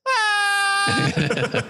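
high shouted scream of alarm; scream of terror. Retold or imitated. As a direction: let out a sudden mock scream. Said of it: "and you hear".

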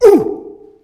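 loud high whoop across the valley; echo call. Shown as a demonstration. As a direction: call out Ooh so it echoes. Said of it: "you stand on the bridge and you go".

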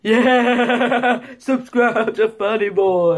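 loud, high, hammed-up surfer dude. Shown as a demonstration. Vlogger playing a surfer plugging his channel, loud whooping dude. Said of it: "Surfer dude".

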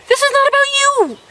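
shrill-free exasperated high take. Shown as a demonstration. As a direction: fire it off high and fast.

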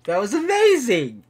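high, loud burst of amazement; real jubilant exclamation; elated shout. Real, not acted. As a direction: belt it out, thrilled by the win. Repeats it louder in elation.

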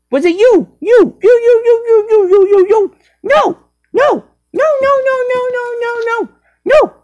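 high squeaky accusing chant; frantic squeal. Acted. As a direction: squeal the words in panic.

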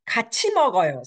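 "먹어요" lifted, then falling as a suggestion; proposal tune. Shown as a demonstration. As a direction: pitch "같이 먹어요" up as a friendly proposal. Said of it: "or it can be proposal".

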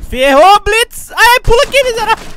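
loud panicked yell; alarmed shout. Real, not acted. Enemy Blitz rushing in.

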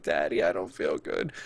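low, listless mock child voice; delirious sick child. Sick daughter telling parent she feels bad, imitated delirious. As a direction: imitate a feverish, woozy kid. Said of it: "all like, delirious".

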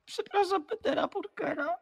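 high squeaky voice. Acted. Forced apology in a put-on voice.

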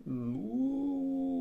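high, drawn-out cow moo; cow's moo. Acted. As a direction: answer like a wary cow. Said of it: "answered Belinda".

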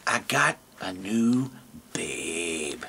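low, soft camel murmur ending in a breathy laugh; smug camel, low and quiet. Acted. Camel brags about new girlfriend.

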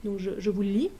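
ordinary delivery

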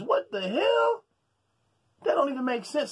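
stretched, high-pitched What the hell; outraged disbelief. Real, not acted. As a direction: drag it out in shock. Ranting about Joshua's reluctance.

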